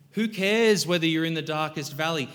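ordinary talk, nothing marked

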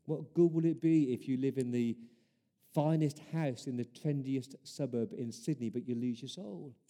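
normal talking voice

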